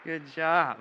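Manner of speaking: loud, high cheering for a friend; hype friend cheering. Real, not acted. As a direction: hype him up to the crowd. Cheering volunteer after trick.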